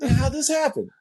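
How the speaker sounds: high-pitched comic disbelief; exaggerated puzzled whine; mock bewilderment. Acts out bewilderment at getting sick.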